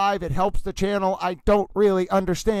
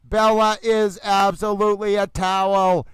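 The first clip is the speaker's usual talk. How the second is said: ordinary talk, nothing marked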